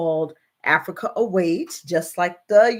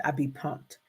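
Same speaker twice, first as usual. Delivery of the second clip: normal talking voice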